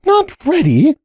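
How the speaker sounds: high, sharp disbelieving question; demanding. A narrator's reading. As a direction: throw it back at him, wild-eyed. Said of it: "Cupcake demanded".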